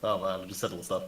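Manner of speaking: usual speaking voice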